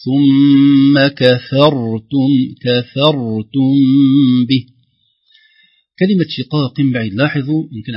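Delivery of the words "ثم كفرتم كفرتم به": very slow, loud chant of كفرتم, thin kaf and fa; light kaf and fa. Shown as a demonstration. Teacher demonstrating the rule, light kaf and fa. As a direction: stretch out كفرتم with only the ra heavy. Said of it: "ترقيق الكاف والفاء ثم تفخيم الراء".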